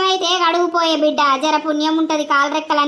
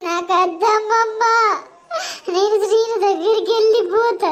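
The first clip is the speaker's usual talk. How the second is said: high, slow, crying voice; sobbing plea; 4 semitones higher than usual. Acted. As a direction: weep and beg her to help you escape. Bride crying again.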